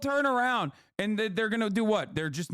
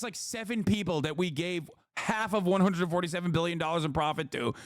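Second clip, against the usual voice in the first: ordinary talk, nothing marked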